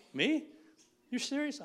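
high, loud, incredulous questioning; mock disbelief. Preacher retelling the call asking him to speak, incredulous. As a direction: replay your astonishment, pitch shooting up.

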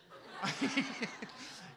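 high, breathy laugh imitating someone; mock laugh. Father imitating his son Jacob filming the healing, mock laughing. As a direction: imitate an excited onlooker's voiceless laughter. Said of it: "Jacob's just like".

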